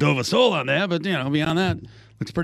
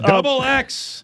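mock drawn-out announcement; 10 semitones higher, much slower than usual. Mocking the shirt size.

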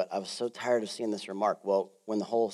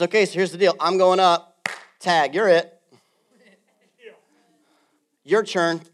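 Jesus passing the baton; 8 semitones higher, 10 dB louder than usual. Acted. Pastor voices Jesus handing over.

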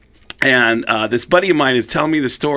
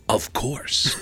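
low, soft, unbothered reply; 4 semitones lower, 9 dB quieter than usual. Retold or imitated. Rock star admitting a hookup to colleague, low and cool. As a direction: answer quietly, as if it's obvious. Gene's cool answer retold.

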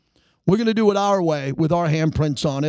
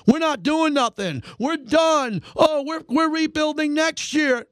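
higher mock-whiny fan voice; mock angry fan; 9 semitones higher than usual. Host mimicking angry Raider fans calling in, mock-whining higher. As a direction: parody the griping callers. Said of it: "All you wanted to do was yell at me".

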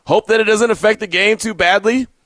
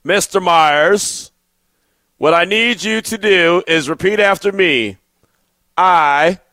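very slow, deliberate stern teacher imitation; long pauses, a teacher who means business; Mister Cepeda, slow and intimidating; slower than usual. Host imitates his Spanish teacher ordering him to repeat, slow and intimidating. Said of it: "he said it very slowly, so you know he meant business".

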